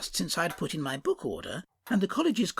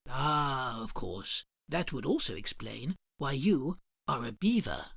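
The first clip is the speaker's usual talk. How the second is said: low polite customer; 4 semitones lower than usual. Acted. Customer remarks on the shopkeeper's voice, low and prim.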